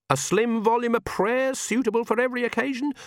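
high-pitched put-on old witch voice; insistent questioning Granny. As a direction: keep pressing with a high, hopeful query. Granny keeps pressing with hopeful questions.

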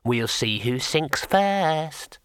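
imp voice. A narrator's reading. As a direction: drawl it like a smug imp.

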